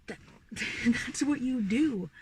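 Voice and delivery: laughing. Real, not acted. Laughing at own rant.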